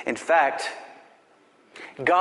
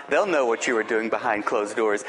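ordinary talk

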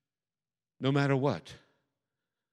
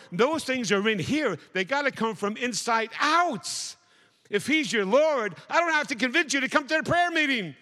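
fiery preaching; 8 semitones higher than usual. Acted. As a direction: preach it hard to the congregation. Preaching builds to full force.

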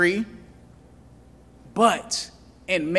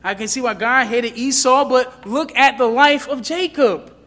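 higher, louder voice of a doubter; protesting objector; 5 semitones higher, 5 dB louder than usual. Acted. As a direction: argue back as a challenging listener. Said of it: "But then you say".

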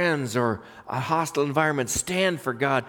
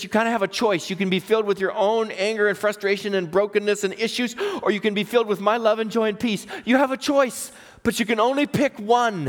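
high, pressing delivery of a choice; urgent pulpit appeal; emphatic preaching; 7 semitones higher than usual. Retold or imitated. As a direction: lay out the options with rising insistence. Preaches God's words with force.

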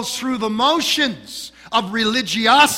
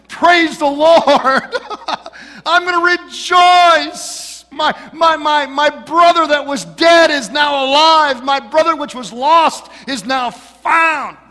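higher, louder, laughing mock joy; mock excited rejoicing; 9 semitones higher, 9 dB louder than usual. Preacher mocking the older brother's missing joy, excited shouting. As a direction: shout it like a jubilant brother, hammed up. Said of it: "Was he all excited and said".

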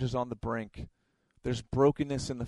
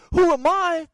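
very high, loud, cocky yell; indignant shout; 9 dB louder than usual. Retold or imitated. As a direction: shout your own name like a champion. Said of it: "in my head, fool, I'm thinking".

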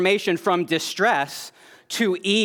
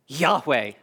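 ordinary talk, nothing marked

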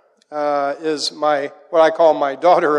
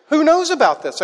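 put-on higher, earnest little girl asking; curious child; 10 semitones higher than usual. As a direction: play the nine-year-old, puzzled. Child asking dad, retold.

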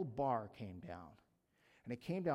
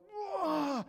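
a high, louder ride-scream "whoa"; retold whoa; 13 semitones higher, 6 dB louder than usual. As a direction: let out the roller coaster whoa. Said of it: "and I'm going".

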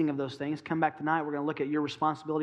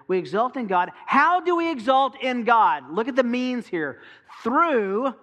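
higher, louder, fervent preaching; preaching at full force; 4 semitones higher, 5 dB louder than usual. Acted. Pastor urging the congregation, fervent loud preaching. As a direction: drive it home to the congregation.

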